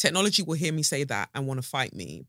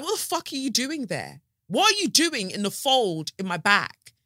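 mock indignation; 7 semitones higher, 4 dB louder than usual.

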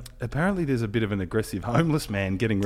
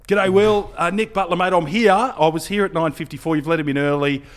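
higher and louder, rushed and pleading; urgent phone plea; 7 semitones higher, 5 dB louder than usual. Retold or imitated. Reporter phoning the media manager, higher, louder and urgent. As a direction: call in a panic to get let in.

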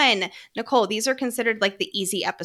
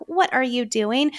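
ordinary talk, nothing marked

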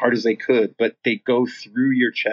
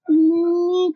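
strained mock grunt; 4 dB louder than usual. Retells being shocked mid-word.

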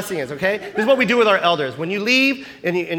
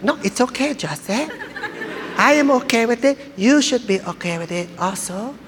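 Lola Tinay; 3 semitones higher than usual. Retold or imitated. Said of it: "She's like".

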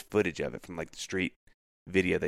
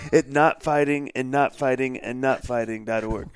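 slow, loud, higher joke web address; mock website plug; 5 semitones higher, 6 dB louder than usual. Friend tops the lawyer website joke, loud mock plug. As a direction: spell it out slowly like a silly plug.

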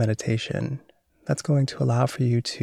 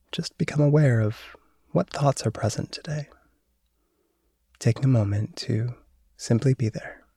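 guided meditation. Acted. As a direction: calmly guide awareness of thought. Leads a mindfulness meditation.

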